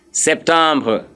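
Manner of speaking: usual speaking voice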